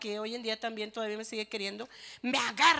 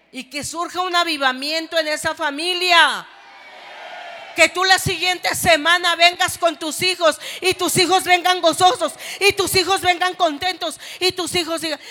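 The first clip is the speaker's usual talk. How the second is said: shouted revival preaching, much louder and higher; full-force shouted sermon; 7 semitones higher, 16 dB louder than usual. Acted. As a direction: cry it out to the whole church.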